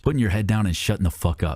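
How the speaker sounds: ordinary delivery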